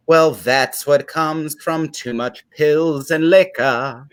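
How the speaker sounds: a hint of British, crooning a sordid song; British accent. Game player to the group, singing in a light British accent. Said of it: "I'm gonna do a British accent".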